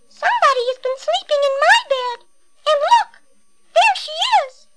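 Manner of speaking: put-on very high, squeaky baby bear voice; little small wee voice. A narrator's reading. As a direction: squeak it out like a tiny wailing cub. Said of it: "in his little small wee baby voice".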